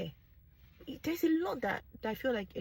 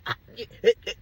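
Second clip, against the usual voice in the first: loud higher laughter; laughing; 4 semitones higher, 3 dB louder than usual. Real, not acted. Vlogger laughing at her own joke, loud laughter. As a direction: burst out laughing. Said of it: "I'm laughing about it".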